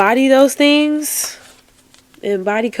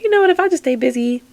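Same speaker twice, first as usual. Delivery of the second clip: quick, higher voice; imitating viewers; 6 semitones higher, faster than usual. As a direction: rattle it off like a breezy excuse. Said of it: "y'all could be like".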